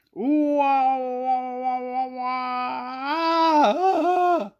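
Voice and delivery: high, loud screaming wail; terrified wailing animals. Acted. Farm animals scared by the creature, high loud wails. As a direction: wail back in terror. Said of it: "went the animals".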